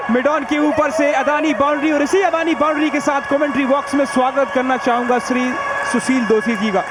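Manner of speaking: ordinary delivery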